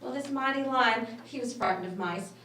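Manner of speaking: usual speaking voice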